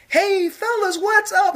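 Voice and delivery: high goofy casual dude voice; high mocking bro voice; mock Jesus voice. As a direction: play him as a laid-back surfer dude.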